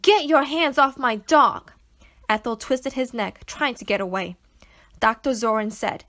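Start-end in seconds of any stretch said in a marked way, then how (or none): 0.0-1.7 loud, slow furious shout. Acted. Girl shouting at the doctor to free her dog, furious. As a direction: yell it at the man holding the dog. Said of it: "I shouted".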